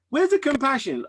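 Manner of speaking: high, loud, disbelieving; incredulous mock question. Host mocking his own hard heart, incredulous and loud. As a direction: throw the question up in mock outrage.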